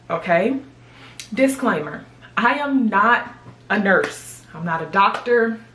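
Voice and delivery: usual speaking voice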